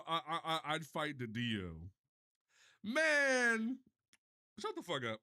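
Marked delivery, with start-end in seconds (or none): 2.8-5.2 high, loud burst of annoyance; sudden loud groan of frustration; exasperated yelp. Real, not acted. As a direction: cut yourself off in disbelief. Reacting to clip of himself.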